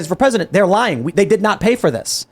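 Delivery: ordinary talk, nothing marked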